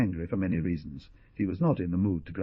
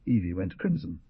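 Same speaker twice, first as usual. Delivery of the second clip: usual speaking voice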